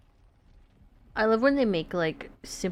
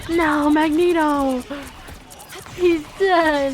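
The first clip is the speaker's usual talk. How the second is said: loud, high, shocked cry; shocked shout; 8 semitones higher, 9 dB louder, much slower than usual. Real, not acted. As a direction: yell out in dismay. Teammate dies in game.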